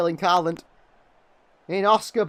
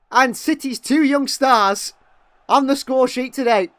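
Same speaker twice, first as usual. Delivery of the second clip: still elated; 5 semitones higher, 5 dB louder, slower than usual. Real, not acted. After the goal, still elated.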